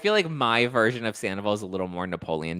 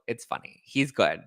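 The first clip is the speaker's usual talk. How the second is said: usual speaking voice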